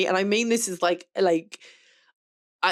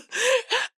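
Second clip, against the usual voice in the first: high laugh. Real, not acted. Laughs after mock affirmation.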